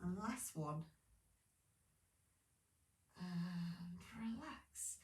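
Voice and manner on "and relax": slow breathy whisper, letting go; soft slow whisper to end the set; whispered relax. Real, not acted. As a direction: breathe it out slowly like a sigh. Instructor easing class out.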